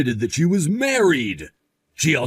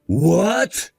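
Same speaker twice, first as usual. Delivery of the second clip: higher, rising shocked question; 4 semitones higher than usual. Acted. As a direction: blurt it out at sudden news. Told that she is pregnant.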